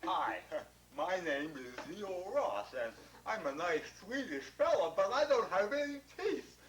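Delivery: gummy toothless mumble, no teeth at all; toothless talk. Retold or imitated. Toothless Swedish fella introducing himself. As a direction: lips folded over bare gums. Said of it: "he sounded like this".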